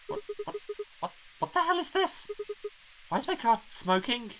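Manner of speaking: very high-pitched, stammering alarm; panicked alarm. Acted. Driver finds his car smoking, high panicked yelp. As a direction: squeak it out loudly as panic rises.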